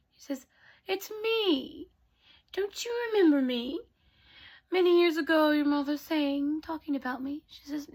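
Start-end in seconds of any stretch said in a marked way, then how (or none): 0.9-7.4 high, lilting storybook godmother voice; kindly fairy godmother. Acted. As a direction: reintroduce yourself in a higher, sing-song voice. Teller plays the fairy godmother.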